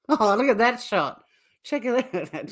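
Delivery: ordinary delivery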